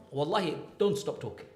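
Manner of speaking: usual speaking voice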